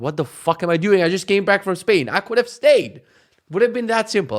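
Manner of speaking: loud, high-pitched exasperation; annoyed rant. Retold or imitated. Husband fetching logs after leaving Spain, loudly fed up. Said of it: "I was so fucking annoyed".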